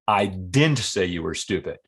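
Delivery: flat-out denial, hitting "didn't"; stress on DIDN'T. Shown as a demonstration. Demonstrating a flat out denial, stress on "didn't". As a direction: punch "didn't" to deny it outright. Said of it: "Flat out denial".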